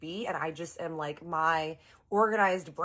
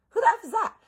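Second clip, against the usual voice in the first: much higher and louder, startled; shocked question; 7 dB louder, faster than usual. Retold or imitated. Seeing a stranger in the family photo, stunned. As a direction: blurt it out in shock. Spotting unknown boy in photo.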